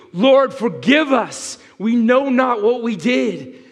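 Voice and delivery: high, loud, slow penitent prayer; pleading with God for forgiveness, slowly and fervently; humble plea. Pretend. Adam and Eve beg God's forgiveness, high and fervent. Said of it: "said, in their naked state".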